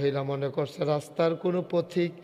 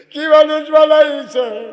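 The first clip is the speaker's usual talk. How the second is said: high, loud, slow cry; cried-out amazement; 10 semitones higher, 15 dB louder than usual. Acted. Preacher voices angels amazed at Umar, crying out loudly. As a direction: stretch the question out in a loud cry.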